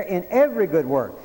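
"every" hit hard in "in every good work"; stress on EVERY. Shown as a demonstration. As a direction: punch the word "every" like a preacher driving it home. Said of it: "notice the emphasis there".

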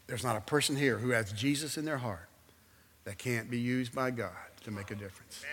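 hushed pastoral appeal; altar call. Acted. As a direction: coax them softly to come forward. Altar call with bowed heads.

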